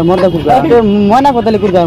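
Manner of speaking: ordinary talk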